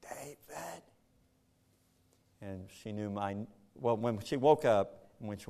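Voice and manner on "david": a soft, breathy-free faint imitation of his wife saying his name; imitating her weak voice. Husband imitating his wife's first faint word. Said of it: "She talked like this".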